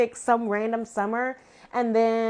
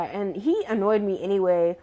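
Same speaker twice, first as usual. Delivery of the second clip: ordinary talk, nothing marked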